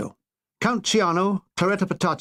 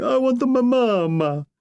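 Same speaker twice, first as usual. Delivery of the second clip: mock crying; 6 semitones higher, slower than usual. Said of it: "Count Ciano crying".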